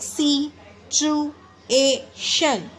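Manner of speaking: Situation very slowly as si-chu-e-shan; four separate beats on situation; syllable by syllable. Shown as a demonstration. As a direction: say situation with long gaps between syllables. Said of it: "हमने four अलग-अलग तरीके से".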